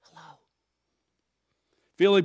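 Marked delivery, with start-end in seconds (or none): 0.0-0.4 hushed, breathy little whisper; child's whisper. A narrator's reading. Child answering the boss's phone call, whispering. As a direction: whisper it like a small child hiding. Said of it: "greeted with a child's whisper".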